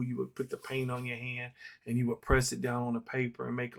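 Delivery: ordinary delivery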